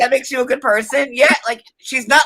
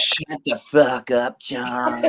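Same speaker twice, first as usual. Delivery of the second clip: low, slow mock of her; mocking imitation; 9 semitones lower, 5 dB quieter, much slower than usual. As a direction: parody her line deep and slow. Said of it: "Don't you mean".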